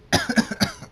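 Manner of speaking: loud, sickly coughing fit. Acted. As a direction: cough weakly like a feverish child. Sick filly coughing.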